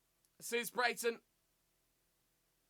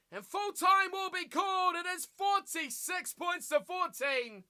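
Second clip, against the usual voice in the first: raised, drawn-out announcer call; full-time commentary call; 6 semitones higher, slower than usual. Real, not acted. Commentator announcing the final score to viewers, raised full-time call.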